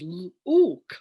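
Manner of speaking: "Look" said as "luke", with a long oo; imitated learner saying LOOK with the oo of "moon"; sounded out wrongly. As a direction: stretch LOOK into a loud "l-ooook".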